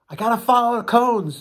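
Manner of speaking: high, strained comic voice; mock panic. Nervous new captain navigating out past buoys, mock panic. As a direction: fret it aloud at a high pitch.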